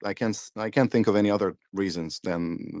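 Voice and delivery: usual speaking voice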